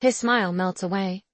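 usual speaking voice

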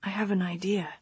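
soft breathy whisper. A narrator's reading. Lover's intimate reply.

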